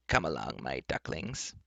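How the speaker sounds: low, soft enchantress coaxing her prey; lightly cheerful, voice dropped low and soft. Acted. Enchantress to the frightened companions, cheerful. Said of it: "said cheerfully".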